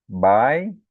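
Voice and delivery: "Bai" alone, loud, one gliding syllable; isolated diphthong. Shown as a demonstration. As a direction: punch "Bai" as a single syllable, a into i. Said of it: "tem um ditongo". ("Bai" isolado e forte, ditongo ai)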